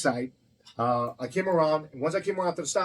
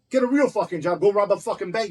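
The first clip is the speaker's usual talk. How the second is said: louder, higher mock-furious jab; retold angry insult; 5 semitones higher, 5 dB louder than usual. Owner telling off an officer in court, retold heated insult. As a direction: tell him off with heated scorn.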